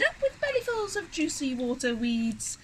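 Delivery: high-pitched duckbill chant; hooting. A narrator's reading. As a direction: start high and hoot the slogan. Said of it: "and they hooted".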